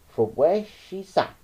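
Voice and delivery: usual speaking voice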